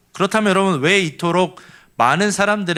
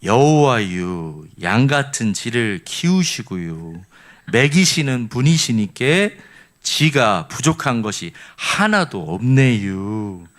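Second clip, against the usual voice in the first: Chungcheong dialect; 4 semitones lower, slower than usual. Shown as a demonstration. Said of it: "충청도 버전은요".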